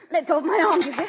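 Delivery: high, loud command; furious struggle. Acted. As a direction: wrench free of the nurse. Nurse holds her arm.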